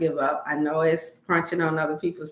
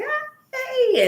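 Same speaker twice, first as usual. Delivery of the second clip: very high, squealed greeting; high-pitched retold "hey"; excited greeting. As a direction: imitate a delighted squeal of hello.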